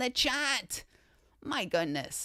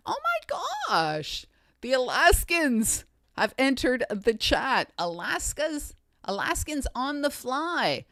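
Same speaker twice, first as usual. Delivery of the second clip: excited announcement; 5 semitones higher, 5 dB louder than usual. Real, not acted. Streamer seeing the Alaskans enter the chat, excited. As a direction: announce a thrilling arrival.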